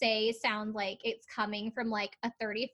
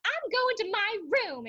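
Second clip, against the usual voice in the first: put-on high, pouty little-kid voice; mocking a child's tantrum exit; mock toddler huff; 11 semitones higher, 3 dB louder than usual. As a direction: huff it like a sulking toddler storming off. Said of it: "her being like".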